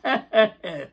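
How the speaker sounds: rhythmic forced laugh on hey. Shown as a demonstration. Teacher answering an angry person, laughing hey-hey chant. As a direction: laugh off anger with pulsing heys. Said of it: "Somebody is mad at you, say".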